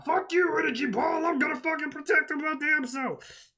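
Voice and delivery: defiant shout. Retold or imitated. Hercule defending his granddaughter, high hammed-up shout. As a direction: bellow it like a desperate hero protecting family. Hercule defying the energy ball.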